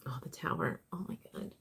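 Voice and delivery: usual speaking voice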